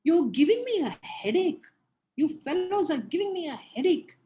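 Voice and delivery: loud, exasperated complaint; irritated parent. Shown as a demonstration. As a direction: snap at the noisy kids. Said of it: "Don't say".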